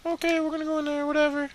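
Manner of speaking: put-on squeaky falsetto kid voice; kid's grudging reply. Kids told to go to a meeting, squeaky mock reply.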